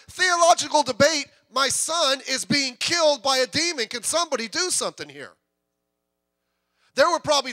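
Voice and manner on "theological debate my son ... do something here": desperate father. Retold or imitated. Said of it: "and he's like".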